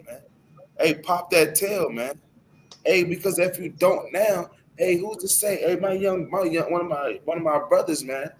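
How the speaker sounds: usual speaking voice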